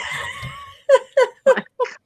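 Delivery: very high helpless laughter; shrieking laugh. Real, not acted. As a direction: laugh till it squeaks. Laughing at own story.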